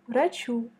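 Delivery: ordinary talk, nothing marked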